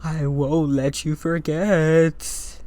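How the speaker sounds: slow, drawn-out eerie threat; spooky, lingering delivery; haunting ghostly voice. Acted. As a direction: stretch every word like a haunting spirit.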